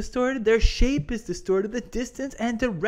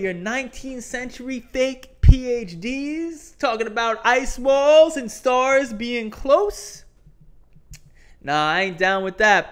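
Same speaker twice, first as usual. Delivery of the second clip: wrestling promo; 5 semitones higher than usual. Pretend. Promo call-out to flat earthers.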